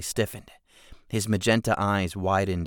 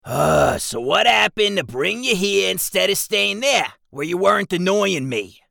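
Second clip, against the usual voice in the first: high, loud goblin complaint; annoyed goblin; 11 semitones higher, 7 dB louder than usual. Acted. Jigsaw snaps at Keswick, loud and high. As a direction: needle him like an irritated goblin.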